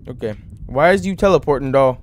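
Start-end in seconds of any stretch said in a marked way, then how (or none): none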